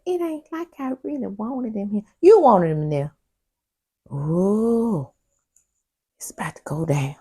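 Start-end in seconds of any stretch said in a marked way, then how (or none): 0.0-2.0 mock dismissive quote. Quoting castmate's dismissive line.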